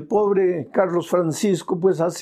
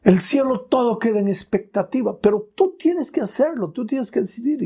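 urgent appeal; 8 semitones higher than usual. Acted. Preacher urging listener.

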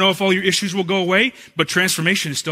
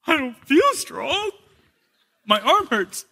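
put-on little boy voice; 7 semitones higher than usual. Son answers father sadly.

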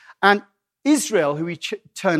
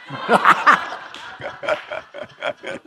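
laugh; 3 semitones higher than usual. Real, not acted. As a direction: burst of laughter, then chuckling. Amused by his own joke.

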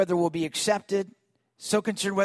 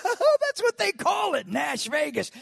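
excited; 7 semitones higher, slower than usual. Retold or imitated.

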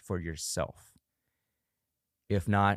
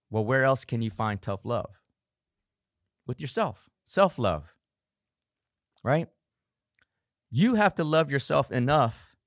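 usual speaking voice

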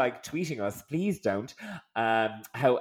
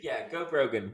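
normal talking voice

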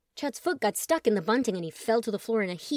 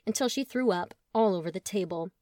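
ordinary delivery, nothing marked

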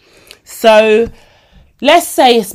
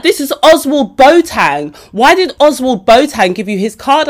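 exasperated; 6 semitones higher, 4 dB louder than usual. Retold or imitated. [name] exasperated in retelling.

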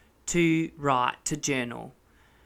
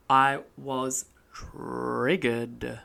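slow and low; 6 semitones lower, 4 dB quieter, much slower than usual. Real, not acted. Confessing with mock gravity.

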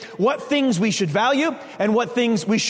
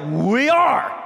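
louder, higher, mock group answer; disciples calling out; 4 semitones higher, 4 dB louder than usual. Disciples answering Jesus all at once, loud mock reply. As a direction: shout it back like a crowd. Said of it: "And they're all like".

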